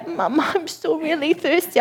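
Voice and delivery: put-on high, soft thirsty child; little child voice. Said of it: "her little voice".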